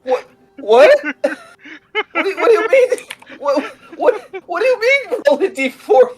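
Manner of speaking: high, laughing what what; baffled and laughing; shocked disbelief. Real, not acted. Shocked by the reroll.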